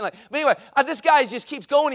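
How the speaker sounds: normal talking voice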